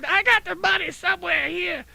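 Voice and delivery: high, loopy imitation of a passenger; mock high-pitched muddled insistence; confused lady. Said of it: "this lady's not making much sense".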